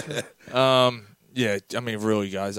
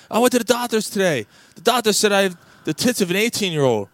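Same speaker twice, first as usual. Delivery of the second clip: loud, high, boastful joke-wife voice; acted-out thrilled bragging; excited wife; 8 semitones higher, 6 dB louder than usual. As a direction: crow it proudly, pitched well up. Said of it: "Why are you so excited".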